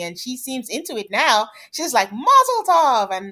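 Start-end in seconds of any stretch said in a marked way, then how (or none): 2.1-3.1 mock cheer. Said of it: "his mom screaming".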